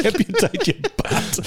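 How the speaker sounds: high, slow mock doctor voice; high laughing mimicry. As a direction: squeak it out slowly through laughter. Mimics the Hibbert line, laughing.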